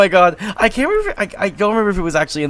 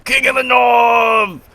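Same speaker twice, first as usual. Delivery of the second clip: higher, louder and slow; triumphant shout; 7 semitones higher, 4 dB louder, slower than usual. Pretend. Friend chanting the story's climax, triumphant war cry.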